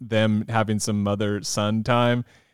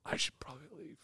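voiceless aside to yourself; whispering; 22 dB quieter, faster than usual. Retold or imitated. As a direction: whisper it under your breath. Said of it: "sitting there like".

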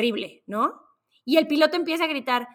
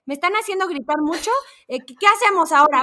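higher, louder frantic rant into the mic; panicked pilot; 6 semitones higher, 5 dB louder than usual. Retold or imitated. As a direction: rant like a pilot coming apart. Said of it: "el piloto por el micrófono". (piloto gritando por el micrófono a los pasajeros, desesperado)

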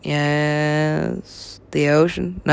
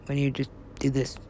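usual speaking voice

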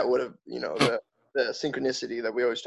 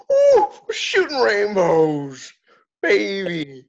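high, slow, squealing silly voice; silly falsetto; 6 semitones higher, 5 dB louder, much slower than usual. Pretend. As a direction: squeal it out like a giddy unicorn. Joining the unicorn bit.

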